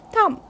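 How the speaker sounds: "thumb" with the final B left silent. Shown as a demonstration. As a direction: say "thumb" ending on M, no B. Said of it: "b is silent".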